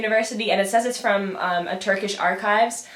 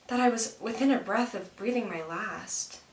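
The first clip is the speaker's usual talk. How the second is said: softer fading realization; 7 dB quieter than usual. Acted. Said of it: "when I have this realization".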